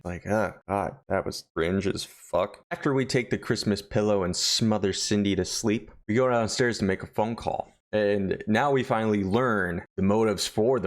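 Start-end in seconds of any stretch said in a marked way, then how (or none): none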